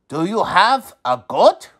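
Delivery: shifted sage voice, rising question; wise man asking. A narrator's reading. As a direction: ask it like a knowing old man. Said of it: "the wise man asked".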